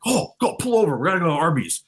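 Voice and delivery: high-pitched excited retelling of a bandmate; imitating bandmate. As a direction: pipe up high like the bandmate calling out. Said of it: "of Johnson going like".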